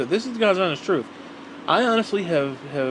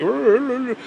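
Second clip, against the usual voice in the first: higher, mocking rrr noises for a nagging voice; mock nagging growl; 7 semitones higher, slower than usual. Mocking stepmother's berating with a higher growling rrr. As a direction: imitate her endless berating with growls. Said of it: "she was like".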